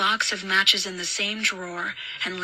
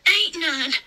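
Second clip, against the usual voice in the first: put-on high child voice; small, high rustic voice; little Southern boy; 9 semitones higher than usual. A narrator's reading. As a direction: answer like a scared country boy. Boy answers the babysitter.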